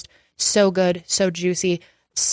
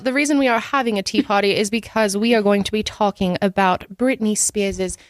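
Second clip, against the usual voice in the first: put-on British accent. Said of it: "You have five more minutes of accent".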